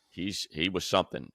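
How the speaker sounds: usual speaking voice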